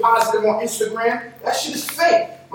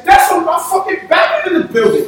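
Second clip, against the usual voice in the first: laughing, hyped-up yell; hyped shout; 6 semitones higher than usual. Real, not acted. Hyped shout in heated podcast.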